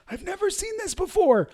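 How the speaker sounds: stumped by a client's broken website; baffled. Retold or imitated. Sudden high baffled squeak. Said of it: "I'm like".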